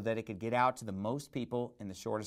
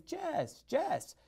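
high-pitched South American "Jess" for yes; South American accent, Y hardened to J; imitating South American accent; 6 semitones higher, much slower than usual. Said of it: "she pronounces it Jess".

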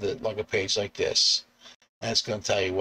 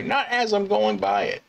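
high-pitched mock rant, easing off; acted exasperation; exasperated complaint; 12 semitones higher, 4 dB louder than usual. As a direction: scold the late passenger in a raised pitch. Driver's rant at passenger.

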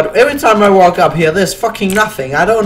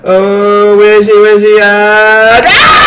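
loud wail building to a high scream; panicked scream; 4 semitones higher, 8 dB louder, slower than usual. Real, not acted. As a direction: panic rising until it bursts into a laughing shriek. Hunted by enemy, builds to scream.

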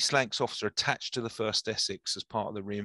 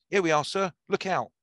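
sudden warning call, higher and louder; guide shouting; 7 semitones higher, 4 dB louder than usual. A narrator's reading. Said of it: "the guide shouted".

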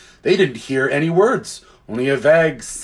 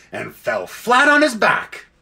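ordinary delivery, nothing marked

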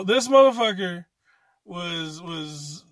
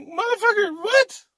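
high, loud disbelieving outburst, slowing on the last line; outraged retort; 8 semitones higher, 4 dB louder than usual. Retold or imitated. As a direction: fire back in mock outrage at the inmates. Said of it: "I'm like".

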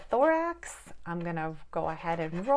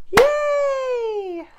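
much higher and louder yay; excited cheer; 10 dB louder than usual. Acted. Parent cheering the roll with her child, excited cheer. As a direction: squeal it like a child.

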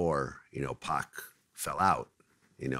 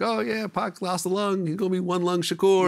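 high, slow, jokey radio voice; mock-cheerful imitation of a radio joke; 11 semitones higher than usual. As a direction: lay the gag on like a wisecracking DJ. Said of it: "DJs were kinda cracking jokes".

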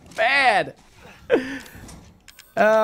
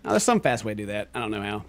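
normal talking voice

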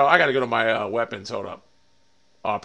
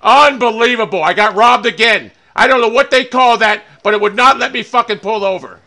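loud, higher-pitched angry yelling at the game; furious rant; 5 semitones higher, 11 dB louder than usual. Real, not acted. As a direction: rage at the car that won't pull over. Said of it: "Oh, I'm so fucking pissed".

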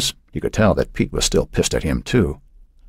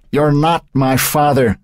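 slow, loud, high outburst; exploding; 11 semitones higher, 4 dB louder, slower than usual. A narrator's reading. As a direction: hurl it at the stepfather. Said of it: "Matt exploded".

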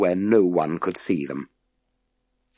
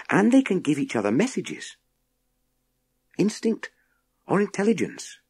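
put-on raised young voice rattling off facts; eager to show what he knows; higher boy's voice, brisk; 7 semitones higher than usual. A narrator's reading. Boy eagerly lists bee facts to the professor, raised and quick.

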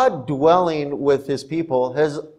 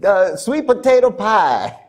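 higher, fast, hammed-up Southern church lady; Southern accent; 8 semitones higher, 3 dB louder than usual. Church member invites the pastor to eat pie, Southern accent. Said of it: "talking like this".

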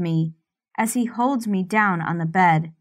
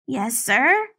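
anxious; 5 semitones higher than usual. Acted.

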